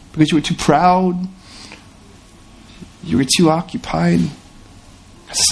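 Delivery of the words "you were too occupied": ordinary talk, nothing marked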